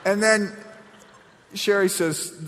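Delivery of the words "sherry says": usual speaking voice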